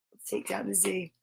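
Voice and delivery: soft, secretive whisper of "Let's take down the Z". Shown as a demonstration. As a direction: breathe the words like a secret. Repeats line whispered, then plain.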